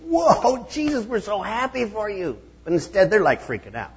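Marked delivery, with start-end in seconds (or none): 0.0-2.4 much higher, bright whoa of joy; delighted cheer. Retold or imitated. Preacher voicing disciples who should rejoice, excited and higher. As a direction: cheer him on like glad friends. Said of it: "really should have been like".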